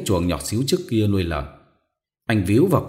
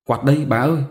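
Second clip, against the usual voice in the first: higher, louder call; 6 semitones higher, 4 dB louder than usual. Acted. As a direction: call it through the doorway. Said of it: "và gọi". (gọi to, giọng cao)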